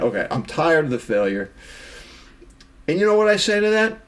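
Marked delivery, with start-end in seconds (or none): none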